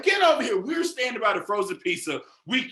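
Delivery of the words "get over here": shouting. Retold or imitated. Scolding nephew in store.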